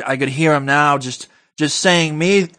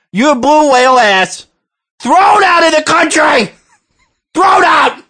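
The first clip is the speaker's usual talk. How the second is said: building rant; 5 semitones higher than usual. Acted.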